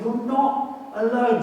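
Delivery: loud and very slow, pressing each word; emphatic reassurance. Real, not acted. The preacher insists to his listeners they are not alone. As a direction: land it hard so they believe it. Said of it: "repeated emphatic reassurance".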